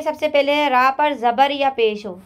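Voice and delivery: ordinary talk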